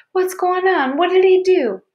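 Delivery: put-on high-pitched, alarmed little girl; frightened child demanding answers; jumpy. A narrator's reading. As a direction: ask in a high, nervous panic. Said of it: "she was starting to get jumpy".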